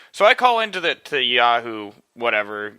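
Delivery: ordinary talk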